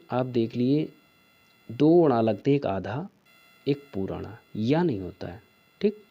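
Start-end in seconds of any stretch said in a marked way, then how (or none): none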